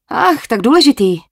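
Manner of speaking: výš a hlasitěji, posměšně; odfrknutí. Acted. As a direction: odfrkni si s ironií. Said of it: "odfrkla si Jet". (Jet se vysmívá J J, ironicky a výš)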